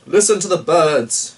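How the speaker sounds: loud, high joyful call; shouted. A narrator's reading. Knight shouting in triumph after the kill, loud and joyful. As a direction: cry it out in triumph. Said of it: "shouted Shonks".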